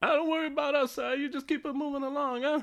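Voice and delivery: high, fast New York rat voice; tough New York rat. Pretend. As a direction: rats in a ball talk tough with a New York accent. Voicing rat king rats.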